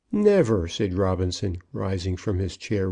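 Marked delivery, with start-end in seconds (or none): 0.1-0.7 high, loud exclamation; ringing, indignant outburst; emphatic protest. A narrator's reading. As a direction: cry out in flat refusal. Robinson springs up to protest.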